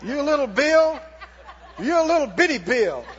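belittling, emphatic taunt; playful, punchy scorn; mocking the bill. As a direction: talk down to a puny opponent. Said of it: "laugh at it and go".

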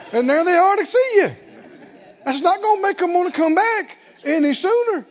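high, loud incredulous preaching; rising, loud mocking exclamation; animated preaching. Real, not acted. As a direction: build it up and drive the point home. Preacher mocks whining visitors.

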